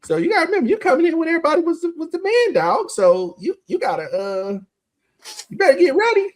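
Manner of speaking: very high-pitched put-on voice; Tyrone Williams. Veteran warning a humbled newcomer, high-pitched imitated voice. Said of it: "Like Tyrone Williams told me one time".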